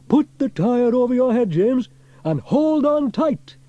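loud, high-pitched shouted instruction. A narrator's reading. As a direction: shout across the water to the boy. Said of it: "shouted Sam".

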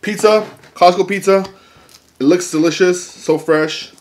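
high, loud and eager; bubbling with excitement; excited. Real, not acted. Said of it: "I'm excited, I'm excited".

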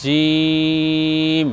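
জিম sung out long and slow; drawn-out Arabic letter. Shown as a demonstration. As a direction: hold জিম as a long even note. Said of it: "আমার সঙ্গে বলতে থাকুন". (জিম টেনে লম্বা করে উচ্চারণ)

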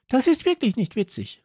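aufgebrachter, hoher Ausruf; empört herausrufen; rief. A narrator's reading. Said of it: "rief Nicole plötzlich". (empört und hoch gerufen)

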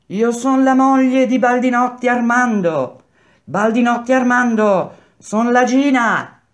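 high, loud, long-carrying call; woman shouting. A narrator's reading. Second wife calling her jailed husband, shouting. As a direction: cry out your name to the prison. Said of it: "gridò la seconda".

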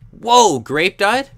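high, loud, slow astonished cry; shocked surprise. Real, not acted. Gamer sees another player named grape died, shocked cry. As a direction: gasp at unexpected news.

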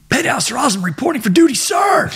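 very high, rapid-fire recruit yell; screamed military report. Retold or imitated. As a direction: report in with over-motivated Marine zeal, ending high on "sir". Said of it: "screaming the fucking top of my lungs".

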